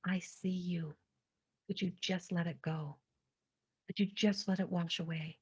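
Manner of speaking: soft whispered reassurance to herself; whispered self-comfort. Retold or imitated. Speaking to her own hurt in a whisper. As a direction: breathe the words like a hushed promise.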